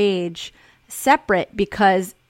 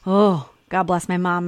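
ordinary talk

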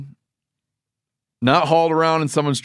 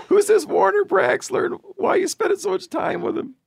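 high, whiny jealous wife. Retold or imitated. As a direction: play the suspicious wife, squeaky and hurt. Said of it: "His wife's gonna be like".

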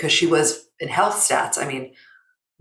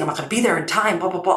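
mock fretting; 5 semitones higher, much faster than usual. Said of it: "This whole chasing".

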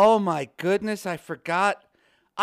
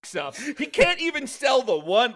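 ordinary talk, nothing marked

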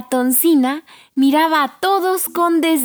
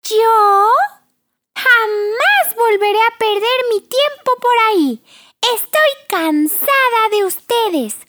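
high, scornful brat voice; snobby dismissal; 9 semitones higher than usual. Acted. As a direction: brush them off with contempt. Pina rudely rejects shelter.

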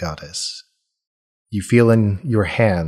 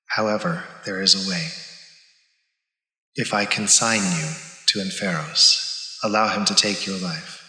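a softer, measured divine calm; cool goddess voice; 4 dB quieter than usual. Acted. Goddess passing judgement on her paladin, cool and soft. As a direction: speak as a serene, distant goddess. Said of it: "Visham's cool voice".

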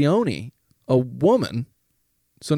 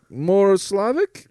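put-on drawn-out, higher Slavic-accented question; imitated Slavic accent; 6 semitones higher, slower than usual. [name] trying another accent on him, questioning Slavic lilt. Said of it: "changed the accent to be".

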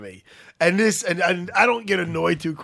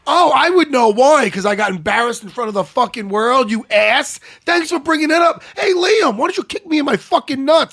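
loud retold rant, rising in pitch; sarcastic tirade; 6 semitones higher, 7 dB louder than usual. Fighter berating the ref for recalling his defeat, loudly sarcastic. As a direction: blast the ref, building to a high shout.

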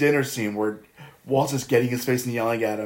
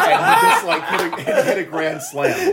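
high-pitched laughing words; breaking into laughter mid-sentence. Real, not acted. Laughing to friends about the pinch hitter, high and giggly.

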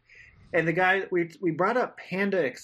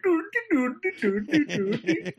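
much higher sing-song doo doo; mock IKEA helper; 12 semitones higher than usual. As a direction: chirp it like a cartoon Swedish helper. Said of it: "They're like".

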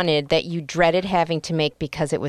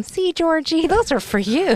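higher, sing-song voice for little Georgie; talking to a child; 12 semitones higher than usual. Pretend. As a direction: coo at a small boy about his fireworks. Parents telling the boy fireworks.

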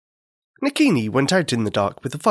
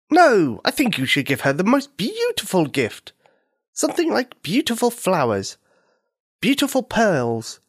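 a bird suggesting pretty gifts; 8 semitones higher than usual. Acted. Mynah bird character speaks.

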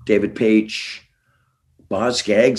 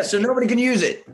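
ordinary talk, nothing marked

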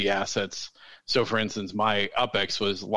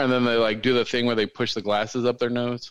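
ordinary talk, nothing marked

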